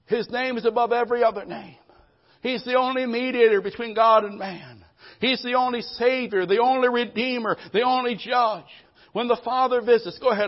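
loud, high, ringing proclamation; full-force preaching. Acted. Preacher proclaiming to the congregation, loud and ringing. Preacher declaring Christ's supremacy.

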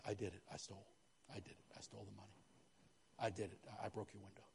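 quiet, humble admission; soft confession. Acted. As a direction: softly own up to what you did. Voicing a confessing person.

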